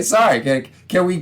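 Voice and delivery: usual speaking voice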